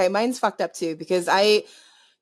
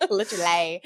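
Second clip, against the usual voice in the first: Essex accent; 5 semitones lower, 5 dB quieter than usual. Shown as a demonstration. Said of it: "Yeah, I can do that".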